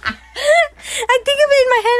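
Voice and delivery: can barely get the words out for laughing; giggling helplessly mid-sentence. Real, not acted. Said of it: "I can't say it 'cause I'm gonna laugh".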